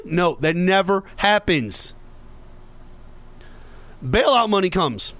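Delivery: ordinary talk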